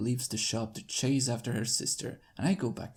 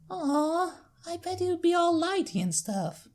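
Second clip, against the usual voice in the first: put-on high little-girl voice, a touch let down; filly voice; 4 dB louder than usual. Apple Bloom imagining the doctor glowing, high filly. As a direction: perk back up like a small child. Filly disappointed then cheerful.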